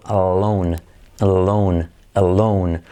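alone with a light tongue-tip L; English middle L. Shown as a demonstration. Teacher drills alone for the middle L. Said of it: "O también solo".

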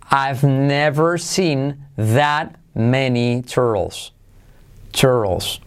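very slow, word-by-word teaching delivery; slow model sentence. Shown as a demonstration. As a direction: spell the sentence out at half speed for learners. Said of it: "La frase que os pongo de ejemplo es". (frase modelo, muy lenta, palabra por palabra)

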